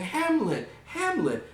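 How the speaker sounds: very high motherly plea; Gertrude calling out. Acted. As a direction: call your son's name in high-pitched alarm. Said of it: "Gertrude's saying".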